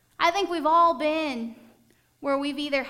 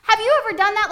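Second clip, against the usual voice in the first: ordinary talk, nothing marked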